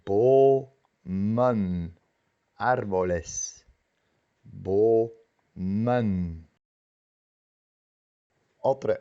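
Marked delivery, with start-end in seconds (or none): 0.1-2.0 "Bomen" with a drawn-out double-length O; long double vowel. Shown as a demonstration. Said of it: "pero se pronuncia doble". 4.4-6.5 "Bomen" with a drawn-out double-length O; long double vowel. Shown as a demonstration. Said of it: "pero se pronuncia doble".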